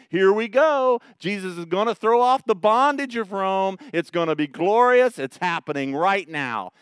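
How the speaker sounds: the disciples' eager hope for revolution; thrilled disciples. Retold or imitated. Disciples imagine victory.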